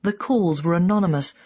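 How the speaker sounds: plain reading voice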